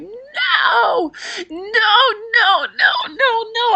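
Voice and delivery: high, loud crying no; sobbing scream. Retold or imitated. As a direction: cry out no, no, no in despair. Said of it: "I just began to scream".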